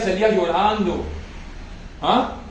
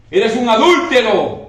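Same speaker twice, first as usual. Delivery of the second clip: louder, slow accusing voice; John the Baptist rebukes the king; bold rebuke; 4 semitones higher, 6 dB louder, slower than usual. Retold or imitated. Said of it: "Juan el Bautista, ¿cómo era?".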